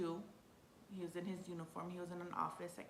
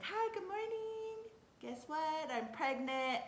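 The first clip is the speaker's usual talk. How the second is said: high, slow sing-song hello; excited announcement; 10 semitones higher, 3 dB louder, slower than usual. Retold or imitated. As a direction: sing out the big news. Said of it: "I was so excited".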